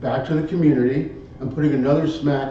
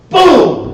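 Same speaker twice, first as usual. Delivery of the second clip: shouted boom; 13 dB louder than usual. Retold or imitated. Narrator bursting the door in, loud boom. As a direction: yell boom as the door gives way.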